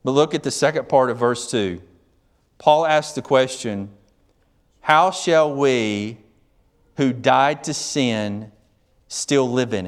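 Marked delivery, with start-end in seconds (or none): 4.8-9.9 slow reading. Shown as a demonstration. Reading Paul's question slowly.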